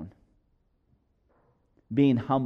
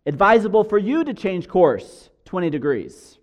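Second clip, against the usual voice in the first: loud, higher-pitched counter-order; replying signal; 5 semitones higher, 5 dB louder than usual. Acted. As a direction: send the order straight back, stressing YOU. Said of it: "A signal comes back".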